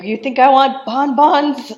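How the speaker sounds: loud, high-pitched retold indignation; indignant mock outburst. Marquis rejecting his wife's sweets, mock outraged shout. Acted quarrel after a slap.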